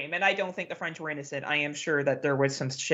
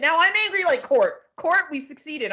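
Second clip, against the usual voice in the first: much higher and louder, fired up; real passionate outburst; 13 semitones higher, 5 dB louder than usual. Real, not acted. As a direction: let the frustration burst out, laughing at yourself. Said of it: "I'm sorry to get so passionate".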